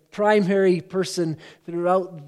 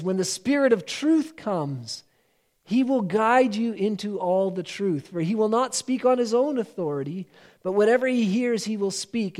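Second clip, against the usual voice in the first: raised, measured Bible reading; scripture reading; 4 semitones higher than usual. Acted. Preacher reads John sixteen aloud to the congregation. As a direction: read Jesus's words with weight.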